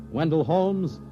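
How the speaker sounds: usual speaking voice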